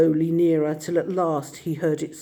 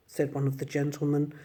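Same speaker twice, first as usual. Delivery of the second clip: ordinary delivery, nothing marked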